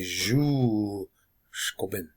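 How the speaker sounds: "ju" stretched out long, "shko bin" kept short; overemphasized long vowel. Shown as a demonstration. Teacher showing long double vowels, "ju" overemphasized and drawn out. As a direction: hold the vowel of "ju", then quick "shko bin". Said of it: "stretch 'em out long, overemphasize".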